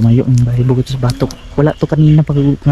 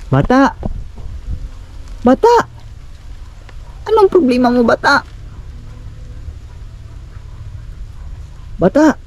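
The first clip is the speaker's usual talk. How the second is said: raised, questioning call; 11 semitones higher, 6 dB quieter than usual. Real, not acted. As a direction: call out across a distance, voice raised high. Calls out to child.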